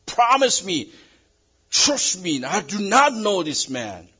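pleading oath. Acted. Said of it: "he said".

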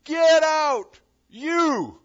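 high, loud thundering command; God shouting down a command; booming voice of God. Acted. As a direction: call him out from above. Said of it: "you hear God's voice saying".

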